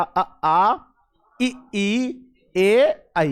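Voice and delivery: अ, आ, इ, ई, ए, ऐ said one at a time with spread lips; vowel demo. Shown as a demonstration. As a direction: call out each vowel distinctly. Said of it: "जैसे अपन ने कहा".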